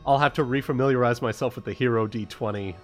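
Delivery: ordinary delivery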